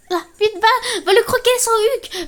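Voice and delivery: high excited urging. Real, not acted. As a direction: hype them up. Urging on in game.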